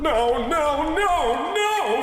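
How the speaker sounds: panicked rising no's. Acted. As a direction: panic climbing to a scream. Creator panics at cartoons.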